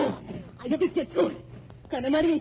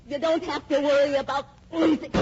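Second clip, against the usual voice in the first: normal talking voice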